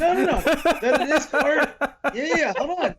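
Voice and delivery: laughing, high-pitched no no no; laughing protest. Real, not acted. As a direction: protest while cracking up. Defending a claim amid laughter.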